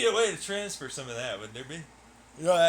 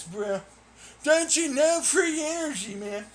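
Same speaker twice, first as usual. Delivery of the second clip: higher, louder hammed-up hippie dude gushing about vibrations; mock free-energy bro; 5 semitones higher, 4 dB louder than usual. Gamer mocks free-energy fans as a hammed-up stoner bro.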